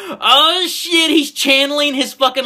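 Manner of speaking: high-pitched excited outburst, reenacted; excited reaction. Fan retelling his reaction as the hero powers up, excited outburst.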